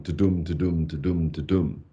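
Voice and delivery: low, monotonous "to-dum" beat; boring march. Shown as a demonstration. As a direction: plod evenly through each "to-dum". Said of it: "rather boring kind of march".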